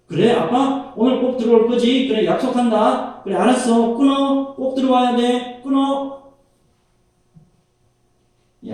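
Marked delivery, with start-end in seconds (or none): 0.1-6.3 put-on high, eager little girl pleading on the phone; higher child voice, pleading; little girl's voice. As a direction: pitch it up like a small child begging dad to come home.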